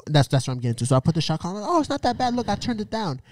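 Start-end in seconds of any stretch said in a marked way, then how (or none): 1.7-3.2 high-pitched, playful reassurance; mock reassurance. Boyfriend excusing the shock collar, high mock reassurance. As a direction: play it down in a high voice. Acts out reassuring his girlfriend.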